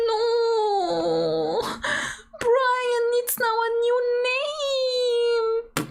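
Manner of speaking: high, wailing mock anguish; mock despair. Real, not acted. Playing to the audience, high comic wailing over the name Brian.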